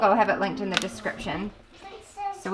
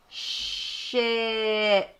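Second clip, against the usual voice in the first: Ship stretched out long; said slowly. Shown as a demonstration. A mom showing her daughter how to say Ship slowly. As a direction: let Ship unroll slowly, sound by sound. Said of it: "Now I'll say ship slowly".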